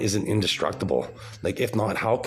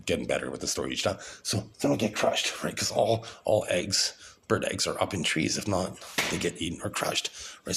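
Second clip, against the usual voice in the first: ordinary talk, nothing marked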